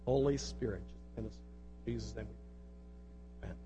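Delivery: low, soft spoken prayer; hushed, intimate address to God. Real, not acted. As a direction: pray quietly on behalf of the room. Leads the congregation in prayer.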